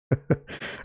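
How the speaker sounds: quiet airy chuckle; short soft laugh; soft breathy laugh. Real, not acted. Laughs at viewer's comment.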